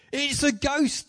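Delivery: high-pitched cry of fright; fearful cry. Acted. Terrified disciples spotting Jesus on the lake, high fearful cry. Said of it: "cried out in fear".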